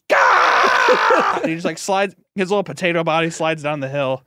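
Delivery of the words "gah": a high, loud hammed-up "Gah!" as he's cut; cartoon pain cry. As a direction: yelp like a sliced cartoon. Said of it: "he's just like".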